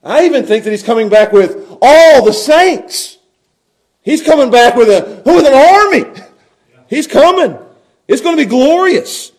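high, building preacher's fervor; impassioned preaching. Real, not acted. Preacher to congregation on the returning angels, impassioned. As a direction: preach it out, swelling on the big words.

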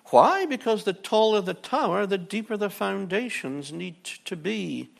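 loud, high preaching emphasis. Acted. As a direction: preach it out with force. Preaching at full force.

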